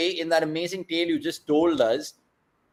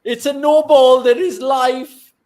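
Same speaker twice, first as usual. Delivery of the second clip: much higher and louder, the last line slow; excited shout; 11 semitones higher, 10 dB louder, slower than usual. Real, not acted. Fan watching the chase cries out at a free hit. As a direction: yell with sudden hope at the no ball. No ball called during tense chase.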